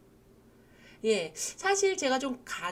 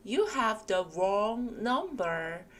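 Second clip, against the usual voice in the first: very slow, carefully spaced English; slow model phrase; much slower than usual. Shown as a demonstration. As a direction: read it out slowly for the class. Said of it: "세게 읽으시면 되겠습니다". (아주 천천히 또박또박)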